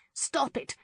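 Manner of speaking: high, urgent cry to break up the fight; high cry, as she jumps between them. A narrator's reading. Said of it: "Lauren cried".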